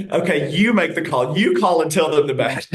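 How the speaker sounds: tossing the hard call onto her, half laughing; mock eager handoff.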